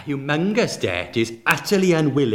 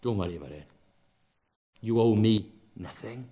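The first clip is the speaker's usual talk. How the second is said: much softer and lower, full of grace; gracious master; 6 semitones lower, 6 dB quieter than usual. Retold or imitated. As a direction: say it like pure grace to a debtor. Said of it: "sheer grace".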